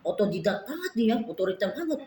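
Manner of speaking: usual speaking voice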